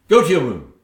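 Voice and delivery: a loud snapped command; angry order. Acted. Stepmother banishing Cinderella, loud angry order. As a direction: send her off with a sharp shout.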